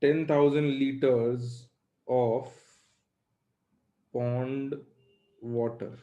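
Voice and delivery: slow, word by word for copying; slow dictation. Shown as a demonstration. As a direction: dictate it slowly so they can write. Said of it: "लिखो". (धीरे धीरे लिखवाना)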